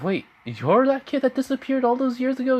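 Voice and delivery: high-pitched, incredulous question; astonished realisation. Retold or imitated. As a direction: voice shoots up in disbelief. Melissa realises who Bon is.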